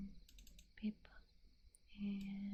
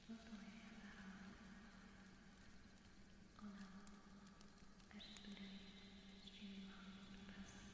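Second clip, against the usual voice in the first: ordinary talk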